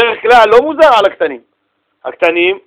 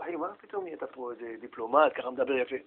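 low, very soft questioning; quiet incredulous aside; 8 semitones lower, 20 dB quieter than usual. Acted. Friend asks father about his sudden diplomacy, quietly incredulous. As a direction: question him under your breath.

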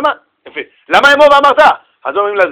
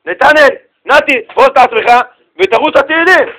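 high, raised summoning voice; father calling his son; 5 semitones higher, 3 dB louder than usual. Acted. Father sends son off to Psalms on Shabbat, calling out. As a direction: call the boy and send him off.

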